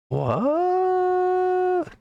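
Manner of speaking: high squeaked cry of disbelief; sudden high yelp of surprise; startled what. Real, not acted. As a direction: jump up in pitch, genuinely thrown. Surprised by missing terminal settings.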